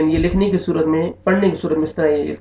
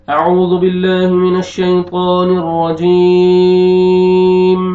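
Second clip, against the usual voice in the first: slow, raised, melodic chanting of the opening formulas; Quranic recitation; 3 semitones higher, 7 dB louder, much slower than usual. Real, not acted. Teacher opening the lesson with a slow recited chant. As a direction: recite slowly and musically as in tajweed.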